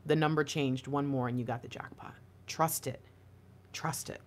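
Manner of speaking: soft, low, confiding hush; hushed reassurance. Real, not acted. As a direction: drop to a near-whisper. Hushed close of a reading.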